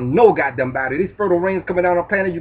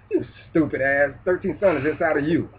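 ordinary talk

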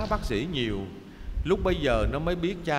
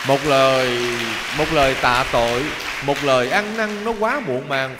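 emphatic lament; 6 dB louder than usual. Acted. A monk preaching a loud lament to children. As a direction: hammer home the lament to the children.

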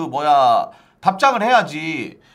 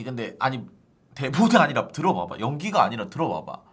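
normal talking voice

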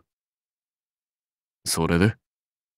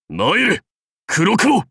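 高く鋭い号令; 愛馬を声高に呼び寄せる; 馬への呼びかけ; 10 semitones higher, slower than usual. Acted. 愛馬を呼び寄せる. (声を張って馬を呼ぶ)

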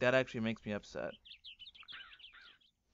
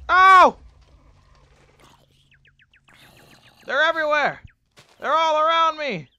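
much higher, loud frightened cries; panicked shouting; 8 semitones higher, 14 dB louder than usual. Real, not acted. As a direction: scream as monsters close in. Surrounded by mobs.

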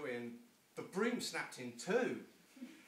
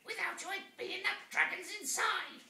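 witch voice; 8 dB louder than usual. Acted. Witch speaking.

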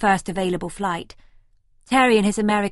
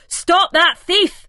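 very loud, much higher, slow desperate yell; scream; 10 semitones higher, 9 dB louder, slower than usual. A narrator's reading. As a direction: yell after the fleeing thief. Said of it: "he screams".

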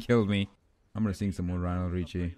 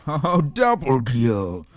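loud, drawn-out "double kill"; excited cheer; 3 semitones higher, 6 dB louder, much slower than usual. Real, not acted. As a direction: stretch it out like an announcer.

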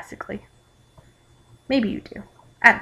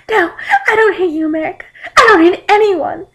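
high, loud tearful joy; hammed-up joyful weeping; happy crying; 12 semitones higher, 7 dB louder than usual. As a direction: cry happily, pitched way up. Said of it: "I said happily, still crying".

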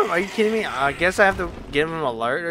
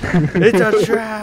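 hammed-up warning; 8 semitones higher, 9 dB louder, slower than usual. Joke about fake bonfire.

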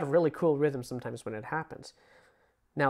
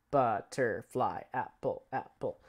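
slow counting words; 2 semitones lower, slower than usual. Shown as a demonstration. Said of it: "whichever works".

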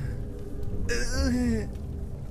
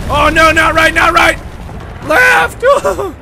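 panicked shouting; 7 semitones higher, 5 dB louder than usual. Real, not acted. Panicked directions while fleeing.

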